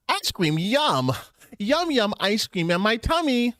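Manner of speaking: high, drawn-out, sing-song cutesy voice; put-on character voice. Streamer imitates a character's ice cream catchphrase, high slow voice. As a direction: stretch it out high like a cute catchphrase. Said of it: "putting on a voice".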